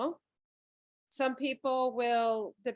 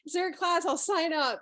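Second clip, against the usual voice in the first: eager sign-up; 8 semitones higher, 6 dB louder than usual. Retold or imitated. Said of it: "I was like".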